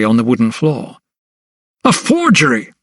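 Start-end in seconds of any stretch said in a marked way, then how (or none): 1.8-2.7 much higher, outraged cry. A narrator's reading. Doctor seeing his forged signature, outraged cry. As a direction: cry it out in disgust. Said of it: "he cried".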